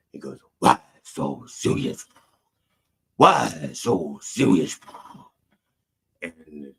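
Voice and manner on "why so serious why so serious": slow, drawn-out put-on voice; mock villain voice. Imitating a candidate's reply to an interviewer, slow and theatrical. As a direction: stretch each word like a hammy villain. Acts out the man's retort.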